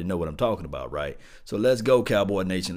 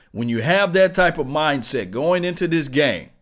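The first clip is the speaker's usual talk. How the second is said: fired-up yelling; 6 semitones higher, 8 dB louder than usual. Real, not acted. Fired-up rant quoting Zeke.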